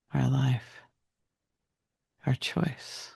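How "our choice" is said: ordinary talk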